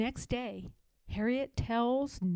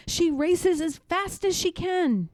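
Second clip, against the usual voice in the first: higher and louder, racing to the end; breathless urgent rise; 9 semitones higher, 8 dB louder than usual. A narrator's reading. Fleeing the hounds, Harriet cries out to God, frantic. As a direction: build to a frantic cry.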